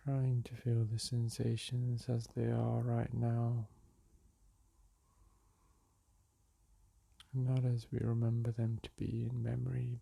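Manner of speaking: calm, unhurried meditation voice with long pauses; soothing meditation guide, leaving space between phrases; guided meditation. Acted. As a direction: guide them gently back to the breath.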